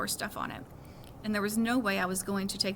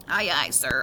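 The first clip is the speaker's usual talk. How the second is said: lightly voiced mock sailor, lower; mock salute; 9 semitones lower, 4 dB louder, slower than usual. Boy salutes his mom's packing orders, mock navy reply. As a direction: play the obedient sailor for fun.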